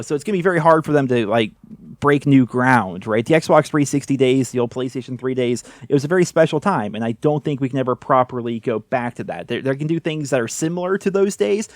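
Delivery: ordinary talk, nothing marked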